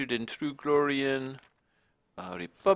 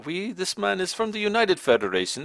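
usual speaking voice

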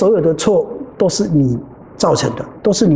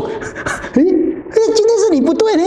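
much higher, put-on whiny accusation; mock accusing brother; 13 semitones higher, slower than usual. Brother blames the teacher, mock squeaky accusation. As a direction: point the finger in a squeaky put-on voice. Imitating brother blaming him.

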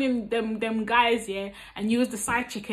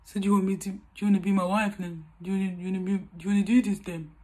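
lightly voiced stammering boyfriend, quick; a hint of a nervous boyfriend; imitating the boyfriend; 5 semitones lower, much faster than usual. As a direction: mumble it fast like an unsure guy. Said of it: "Then he's gonna say".

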